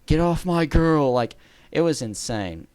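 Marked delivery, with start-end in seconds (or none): 0.1-1.1 raised, louder, drawn-out call; half-strength imitation of a yelling boyfriend; mock yell. Boyfriend warning people off his girl, mock yelling. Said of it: "he just yells".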